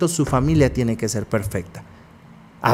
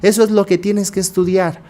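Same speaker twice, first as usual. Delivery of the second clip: higher-pitched imitation of a nagging mother; insistent mother; 6 semitones higher than usual. Therapist imitating mothers who lecture their children, higher and insistent. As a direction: mimic a mother pressing her point, pitched up. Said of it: "cuántas madres no escuchamos que dicen".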